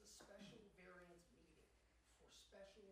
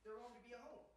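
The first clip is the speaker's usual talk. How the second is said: angry; 10 dB louder than usual. Real, not acted. Angry outburst at zoning meeting.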